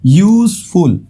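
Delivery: "यूजफुल" as two beats, यूज-फुल; split into syllables. Shown as a demonstration. Teacher counting syllables for students, "यूजफुल" split as यूज-फुल. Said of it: "दो syllable है".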